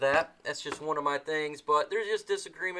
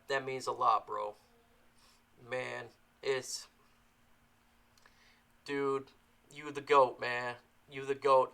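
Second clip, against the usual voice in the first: moved; 3 semitones lower, 3 dB quieter than usual. Real, not acted. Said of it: "I'm not gonna cry".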